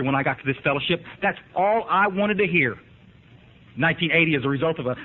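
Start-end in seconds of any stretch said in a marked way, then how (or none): 1.2-2.8 slow, every word weighted on "all"; slow emphatic repeat. Real, not acted. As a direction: say "That's all I wanted to hear" slowly, stressing "all".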